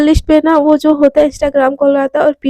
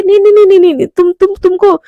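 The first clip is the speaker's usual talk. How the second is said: panicked no; 4 semitones higher, 5 dB louder, faster than usual. Acted. Panicked refusal to boyfriend.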